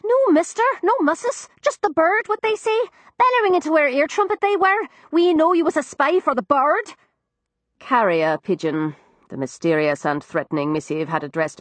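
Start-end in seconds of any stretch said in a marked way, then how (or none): 0.0-6.9 put-on higher, young cockney maid; frightened servant girl, higher cockney; Florrie's voice. Narrator voices East End maid.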